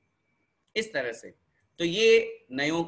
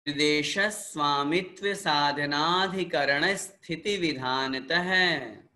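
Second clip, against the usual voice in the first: sutra recitation; slower than usual. Shown as a demonstration. Teacher recites sutras for repetition.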